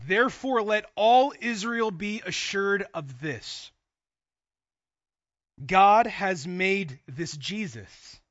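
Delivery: loud, slow scripture declaration; emphatic proclamation. Acted. Peter preaching to all Israel, emphatic proclamation. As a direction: proclaim it with long, weighty stressed words.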